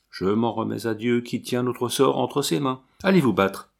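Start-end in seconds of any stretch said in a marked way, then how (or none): none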